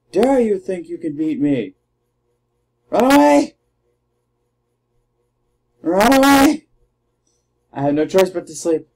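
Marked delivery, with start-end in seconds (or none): 2.9-6.7 high, loud cry of "Run away!"; mock panic, shouting to flee; shouted retreat. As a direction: yell it like a fleeing coward. Fleeing in the game.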